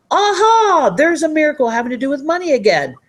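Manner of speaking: high, loud aha of surprise; delighted discovery. Shown as a demonstration. Coach acting out the moment you spot a money miracle, delighted.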